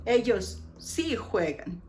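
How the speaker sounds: usual speaking voice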